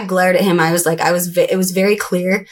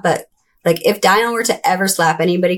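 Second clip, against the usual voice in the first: usual speaking voice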